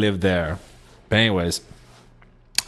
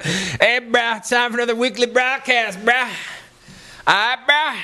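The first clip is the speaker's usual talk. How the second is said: high, hammed-up bro voice; high mock bro-host greeting; bro show host; 12 semitones higher than usual. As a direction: open a show as an exaggerated bro. Imagined bro show opening.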